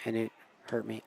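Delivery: ordinary delivery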